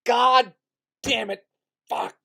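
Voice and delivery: high, loud cursing yells; big cathartic yell. Shown as a demonstration. Getting it out of the system before starting, big yell. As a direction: holler curses into the void, one by one. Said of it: "Just give a big yell".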